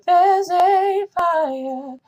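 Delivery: high falsetto "There's a fire," slow and loud; falsetto, the wrong way. Shown as a demonstration. Coach showing the wrong falsetto version. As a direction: sing it all in head voice. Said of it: "So make sure you don't go".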